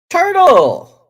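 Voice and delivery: loud, high shout of a name; calling out. Real, not acted. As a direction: hail him loudly across the room. Calling out to a viewer.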